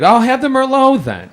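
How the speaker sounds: high, airy customer voice, laughing; a high-pitched, giggly take on the customer; squeaky mock customer. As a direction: pipe it up like a clueless diner.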